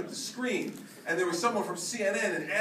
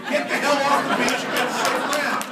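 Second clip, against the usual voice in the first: blunt shouted order; 6 semitones higher, 8 dB louder than usual. Retold or imitated. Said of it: "I looked at my watch and said".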